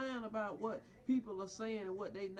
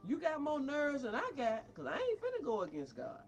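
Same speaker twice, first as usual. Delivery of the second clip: normal talking voice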